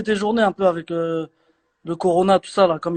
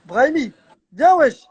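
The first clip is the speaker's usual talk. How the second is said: calling out loud to someone away; yelling across the room; 5 semitones higher, 4 dB louder than usual. Real, not acted. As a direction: shout it over to the next room. Calling Yassine over to join.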